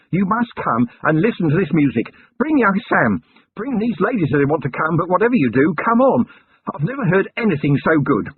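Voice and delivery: bursting in breathless with good news; panted out, excited. A narrator's reading. Husband panting to his wife about the music, eager and breathless. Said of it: "panted out".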